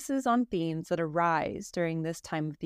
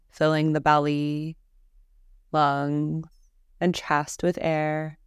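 guided meditation; 3 semitones lower, 6 dB louder than usual. Real, not acted. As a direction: guide them calmly through each breath, unhurried. Leads a guided Yoga Nidra.

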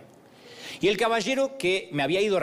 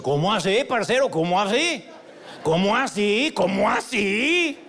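mock-outraged Colombian host, getting more drawn out; indignant raised voice; 3 semitones higher, 3 dB louder, slower than usual. Embarrassed host confronting the hotel clerk, raised voice. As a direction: imitate an embarrassed host protesting loudly. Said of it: "se sintió avergonzado y levantó la voz".

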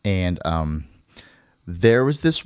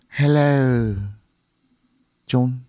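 flirty hello; 2 semitones higher, slower than usual. Retold or imitated. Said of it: "she keeps saying to him".

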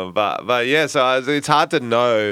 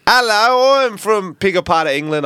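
high, hammed-up English accent; bad English accent; 8 semitones higher, 4 dB louder than usual. Showing off his accent after a UK trip, hammy English. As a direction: put on a loud mock English accent. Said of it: "How's your accent going?".

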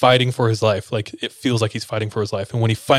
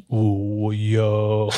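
uneasy reaction. Retold or imitated. Friend watching from the chair as prayer escalates, uneasy. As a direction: stretch it out, taken aback. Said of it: "Zion's in the chair like".

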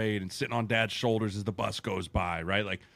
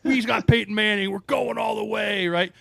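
high, loud fan yell; young Broncos fan cheering in an old post, high and loud; fan shouting; 11 semitones higher, 5 dB louder than usual. Retold or imitated. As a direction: hype it up like a diehard fan. Quoting his old Facebook post.

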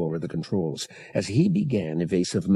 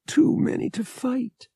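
high, ragged-voiced despair. A narrator's reading. Woman facing a large crew, ragged voice. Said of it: "her voice ragged".

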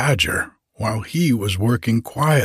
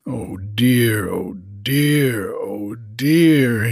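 wailing; much slower than usual. Acted. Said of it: "he wailed aloud".

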